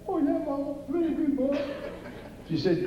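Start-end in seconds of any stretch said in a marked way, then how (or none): 0.1-1.6 put-on high, eager kid's voice promising he's been good; child voice pitched high, overeager; eager little boy. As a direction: play the boy swearing he was good all year. Kid assuring mama he was good.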